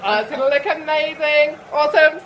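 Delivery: high, loud thrilled laughing; excited. Real, not acted. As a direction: gush with delight. Thrilled at finished wig.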